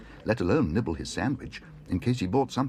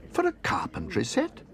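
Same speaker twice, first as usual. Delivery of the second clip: very high squeak of disbelief. Acted. Bear aghast at paying for tools. Paddington shocked at price.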